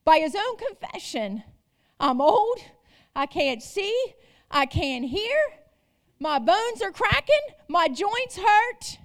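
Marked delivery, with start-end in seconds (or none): none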